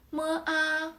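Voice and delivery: "mo, a" high and level; first tone. Shown as a demonstration. Teacher spells out the syllable, "mo, a" high and flat. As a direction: hold "mo, a" flat on a high pitch. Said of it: "The first tone is flat".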